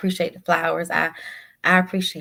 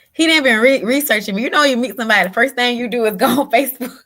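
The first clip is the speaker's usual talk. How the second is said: louder and higher, bubbling with delight; real giddy excitement, louder and higher; excited, laughing; 5 semitones higher, 7 dB louder than usual. Real, not acted. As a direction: gush about him, laughing. Laughing, excited about Ronnie.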